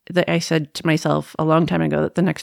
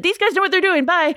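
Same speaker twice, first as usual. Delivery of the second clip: light, high and breezy; chirpy cheerful brush-off; breezy high farewell; 12 semitones higher than usual. Retold or imitated.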